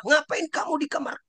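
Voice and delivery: high, sharp mock yell at a hiding child; angry parent yell. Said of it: "dia marah-marah".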